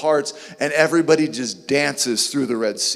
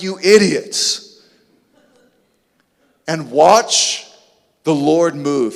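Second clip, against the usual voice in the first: slow, louder, emphatic order; firm command; 5 dB louder, slower than usual. Acted. Moses telling the people to watch the Lord, emphatic. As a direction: drag it out with force. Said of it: "And he's like".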